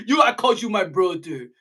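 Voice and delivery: ordinary delivery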